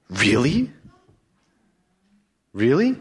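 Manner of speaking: sly serpent, pitch climbing on each really. Acted. Preacher voicing the serpent to Eve, sly and rising. Serpent voiced in Bible reading.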